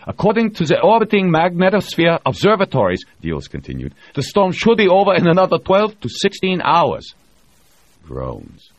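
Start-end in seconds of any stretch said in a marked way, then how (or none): 0.1-7.1 higher, louder excited announcing; Diels voiced. A narrator's reading. Diels reports storm news to the crew, excited. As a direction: share the relief with the room.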